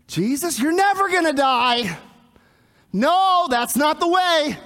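high, rising cry of refusal; vehement protest. Acted. Preacher plays Peter refusing Jesus' death, vehement protest. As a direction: object loudly, pitched well up.

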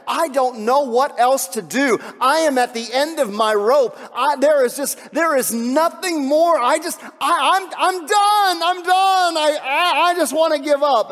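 loud, high, strained cry of someone at the end of their rope; desperate shout of giving up; despairing cry. Acted. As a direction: cry out like you can't go on. Voicing a despairing listener.

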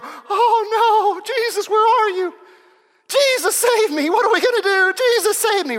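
high-pitched wailing panic; hammed-up helpless cry to Jesus; mock panic. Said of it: "the storms come up and we go".

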